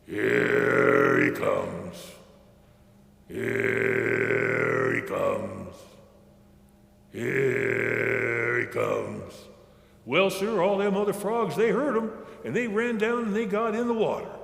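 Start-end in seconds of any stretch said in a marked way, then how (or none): 0.1-9.3 deep drawn-out frog croak; long, low monotone frog call; bullfrog calling. A narrator's reading. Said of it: "he commenced on calling".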